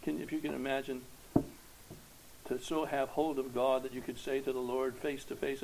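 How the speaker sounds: normal talking voice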